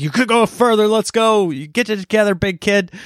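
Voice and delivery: high-pitched, mock-stern prodding; exasperated urging. Dad refusing the kids' excuses near the gate, exasperated urging. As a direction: push a flagging kid onward. Retold dad pushing kids on.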